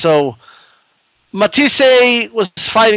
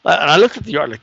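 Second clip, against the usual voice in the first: usual speaking voice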